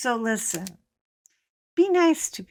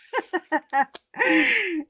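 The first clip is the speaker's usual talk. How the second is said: laugh; 9 semitones higher than usual. Real, not acted. Laughing at her story.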